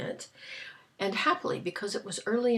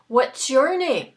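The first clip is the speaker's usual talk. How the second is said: Santa voice; 6 semitones higher, 11 dB louder than usual. Retold or imitated. Santa voiced in retold story.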